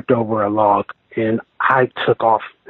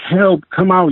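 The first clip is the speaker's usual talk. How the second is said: calling for help; 8 semitones higher, slower than usual. Retold or imitated. Calling out to friend at camp.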